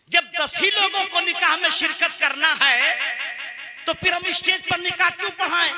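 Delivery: impassioned rhetorical appeal; forceful preaching. Real, not acted. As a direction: put the question forcefully to the crowd. Preaches with raised voice.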